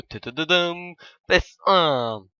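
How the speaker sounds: loud, drawn-out mock drumroll announcement; fanfare reveal. Vlogger unveiling a console to viewers, mock-grand fanfare. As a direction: trumpet it slowly like a big prize, high then booming.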